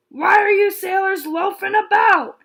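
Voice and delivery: loud, high pirate shout; pirate captain shouting at the loafing kids; pirate growl. Acted. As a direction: bark at the lazy crew. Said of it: "he growled".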